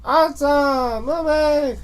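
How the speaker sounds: Sims character call. Pretend. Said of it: "what we wouldn't hear is".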